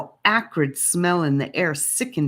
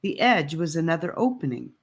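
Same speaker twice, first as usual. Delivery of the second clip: plain reading voice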